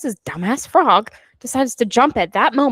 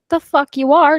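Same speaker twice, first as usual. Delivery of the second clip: louder, higher retort; indignant retort; 5 semitones higher, 7 dB louder than usual. Retold or imitated. Villain refusing to let Evie climb out, loud. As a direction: snap back at her, outraged. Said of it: "and he's like".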